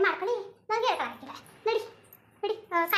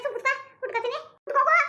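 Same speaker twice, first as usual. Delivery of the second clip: exasperated yell; 7 semitones higher than usual. Acted. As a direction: shout her down in irritation. Mother snaps at stubborn daughter.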